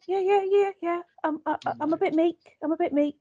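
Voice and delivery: high-pitched, stammering meek Londoner; meek character impression. Co-host mimicking the meek gift-shop worker, high and hesitant. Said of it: "yeah, I talk like this".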